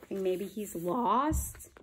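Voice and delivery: ordinary delivery, nothing marked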